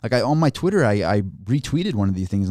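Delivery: ordinary talk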